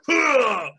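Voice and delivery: loud, high imitated heave; exaggerated effort noise; aggressive shove grunt. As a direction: grunt hard as if shoving someone. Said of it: "he's aggressively pushing them".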